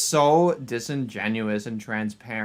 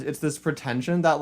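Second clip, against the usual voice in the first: ordinary delivery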